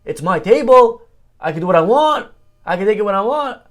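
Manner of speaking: hammed-up angry neighbor shouting; mock yelling. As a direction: retell the neighbor's loud outburst. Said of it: "The guy starts yelling".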